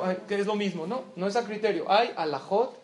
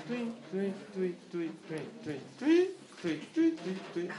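little "tui" noises for dropping bills; playful repeated whistly sound effect; imitated falling banknotes; 6 dB quieter than usual. Shown as a demonstration. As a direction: chirp short, light falling-money sounds. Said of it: "cae el billete así".

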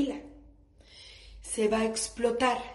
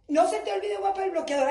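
husband calling out; 7 semitones higher, 5 dB louder, faster than usual. Retold or imitated.

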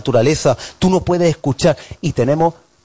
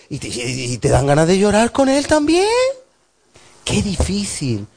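ordinary talk, nothing marked

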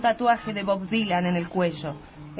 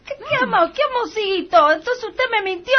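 high, loud, fast threatening greengrocer; high angry Teresa; 9 semitones higher, 6 dB louder than usual. Acted. Teresa threatens the insulting wife, high and loud. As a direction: pitch up and scold the wife hard.

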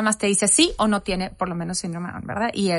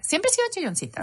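ordinary talk

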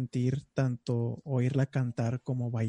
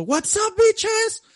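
high-pitched mock party greeting; loud greeting; 5 dB louder, much slower than usual. Instructor bursting into class, squealing high greeting. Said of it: "llegas tú diciendo".

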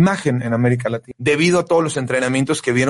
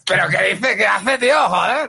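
put-on higher, exclaimed Castilian accent, slower; loud mock Spanish accent; 4 semitones higher than usual. Host fakes outrage at a cohost's fall, in a loud mock Spanish accent. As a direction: bellow it like an outraged man from Spain, Spanish accent. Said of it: "trata de imitar mi acento español".

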